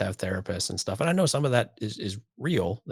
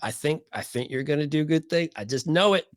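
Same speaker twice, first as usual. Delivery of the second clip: much higher and louder, gushing encouragement; overly cheerful fake believer, hammed up; mock sweet interrogator; 8 semitones higher, 3 dB louder than usual.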